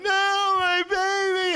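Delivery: high, wailing mock sob; parent crying. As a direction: wail it like a parent losing a child. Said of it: "They're crying".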